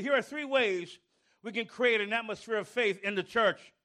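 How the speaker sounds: usual speaking voice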